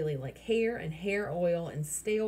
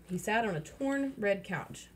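ordinary delivery

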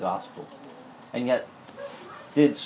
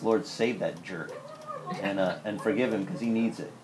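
grudging prayer; 3 semitones lower, 6 dB quieter than usual. Retold or imitated. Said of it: "My idea of bless him is".

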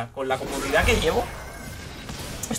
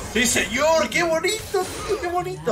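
delighted gloating; 11 semitones higher than usual. Real, not acted. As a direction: savour the play in a high, pleased voice. Delighted after the triple kill.

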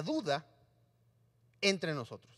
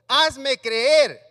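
loud plea; 3 semitones higher, 13 dB louder than usual. Acted. Acting a plea.

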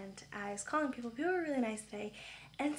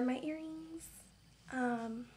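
ordinary delivery

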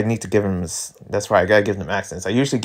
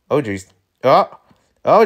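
usual speaking voice

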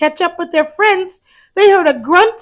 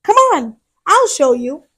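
reassuring; 7 semitones higher, 4 dB louder than usual. Acted. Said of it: "said Fiona".

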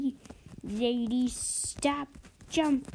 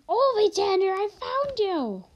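higher, louder excited call; excited shout; 10 semitones higher, 6 dB louder than usual. Real, not acted. Excited shout finding friend.

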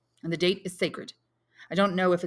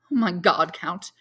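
cried out; 7 dB louder, slower than usual. A narrator's reading. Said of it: "cried the young man".

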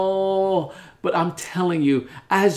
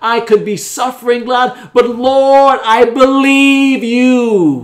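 loud preaching plea, building higher; impassioned prayer; 3 semitones higher, 7 dB louder, slower than usual. Acted. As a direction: build to a high, loud, drawn-out cry of belief.

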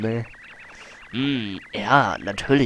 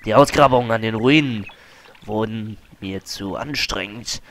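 boastful adventurer. Acted. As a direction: play the vain archaeologist with swagger. Voicing Colorado dialogue.

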